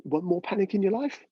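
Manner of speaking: ordinary delivery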